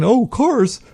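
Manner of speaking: high-pitched mock daydreamer voice; high put-on voice of wandering thoughts; chattering monkey mind. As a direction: ramble off in a high, silly voice. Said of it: "He's not thinking".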